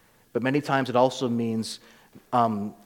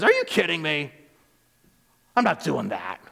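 upset; 3 semitones higher than usual. Acted. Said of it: "he's actually upset".

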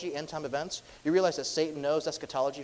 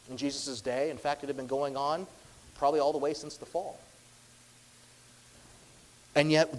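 ordinary delivery

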